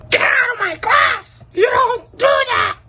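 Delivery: very high, screeching mock teacher yell; hammed-up high shriek of an angry teacher. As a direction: shriek her out of the room like the furious teacher. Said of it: "Miss Keen was like".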